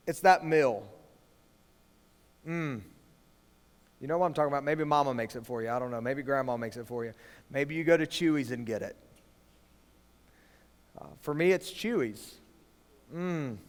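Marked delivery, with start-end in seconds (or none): 2.5-2.8 savoring hum. Acted. Savoring favorite food. 13.1-13.6 savoring hum. Acted. Savoring favorite food.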